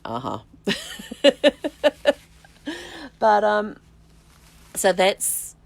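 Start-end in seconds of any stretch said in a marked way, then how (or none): 0.0-0.4 unimpressed uh-huh. Retold or imitated. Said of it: "rolled his eyes and went".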